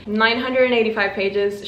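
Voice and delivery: ordinary talk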